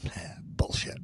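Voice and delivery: whispered scoff, under the breath. Real, not acted. Friend scoffing at promised food, whispered. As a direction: breathe it out like a sneer. Muttered aside.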